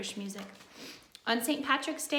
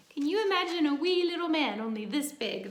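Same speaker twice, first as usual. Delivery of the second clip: higher and louder, delighted at the tiny man; playful wonder; 5 semitones higher, 5 dB louder than usual. Acted. Telling children about leprechauns, playfully amazed. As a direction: invite the kids to picture something tiny and funny.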